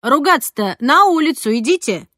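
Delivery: high, loud stern cleaner; cleaning lady ordering. A narrator's reading. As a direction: shoo the kids out, bossy. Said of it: "велела техничка". (строго велела техничка)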